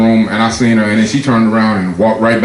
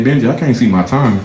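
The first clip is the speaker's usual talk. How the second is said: usual speaking voice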